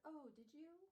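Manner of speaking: high, lilting mock surprise; feigned innocence. Will feigns ignorance when scolded.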